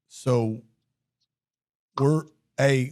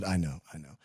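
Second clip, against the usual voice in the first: softer mock apology; 8 semitones lower, 11 dB quieter than usual. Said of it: "I said".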